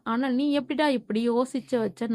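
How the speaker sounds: ordinary delivery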